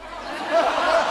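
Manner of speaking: short breathy burst of laughter. Real, not acted. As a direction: laugh it off lightly. Congregation laughs at guitar line.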